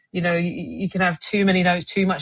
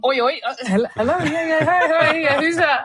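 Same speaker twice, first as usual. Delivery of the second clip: high, laughing switch from casual to polite phone voice; telephone voice; 9 semitones higher than usual. Retold or imitated. She mimics answering the BBC boss's call in telephone voice. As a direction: slide from oi into a chirpy phone voice. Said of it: "Telephone voice".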